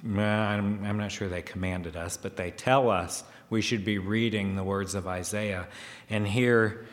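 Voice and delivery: ordinary talk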